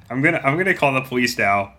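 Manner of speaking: usual speaking voice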